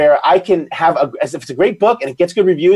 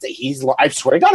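ordinary talk, nothing marked

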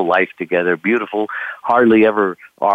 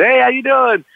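high, louder cheery hello; hammed-up friendly greeting; 4 dB louder than usual. Young skipper greeting the old captain, high cheery hello. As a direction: greet the old sailor brightly.

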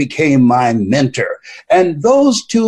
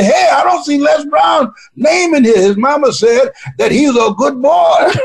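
mock voter shout; 12 semitones higher than usual. Voter in the booth calling out, mock shouting.

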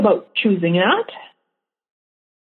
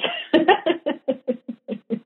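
soft laugh; 5 semitones higher, 7 dB quieter than usual. Real, not acted. Laughs after please.

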